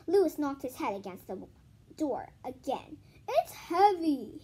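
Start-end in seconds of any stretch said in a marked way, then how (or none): 3.3-4.4 slow, louder whine; complaining. Acted. Louis at the door, slow whining complaint. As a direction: drag out the complaint. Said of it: "he complained".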